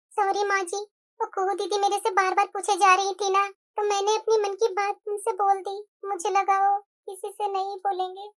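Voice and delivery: soft, slow, sheepish; soft, slow daughter-in-law excusing herself; meek apology. Acted. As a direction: apologise meekly to the mother-in-law. Younger bahu apologises to mother-in-law.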